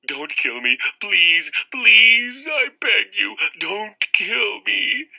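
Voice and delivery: high, loud desperate pleading; begging for mercy. Acted. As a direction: beg for your life, drawing it out. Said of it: "begging for mercy".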